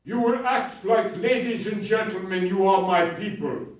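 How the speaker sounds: loud, high stern order to the audience; commanding address. Retold or imitated. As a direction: lay down the law like a stage patriarch. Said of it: "he addresses the crowd".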